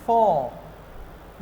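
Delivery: "फॉर्म" as slow "fawm", no R; silent R. Shown as a demonstration. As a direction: draw out the vowel of "फॉर्म", R left silent. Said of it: "इसलिए इसको क्या बोलेंगे".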